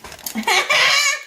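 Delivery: high giggle burst; high laugh. Real, not acted. Caught double dipping, laughing high. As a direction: break into laughter.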